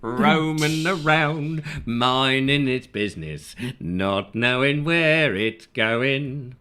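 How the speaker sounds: very slow, stretched-out delivery; slow comic drawl; playful slow musing. Acted. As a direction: draw every word out, savouring the pun. Playfully drawls out listener's pun.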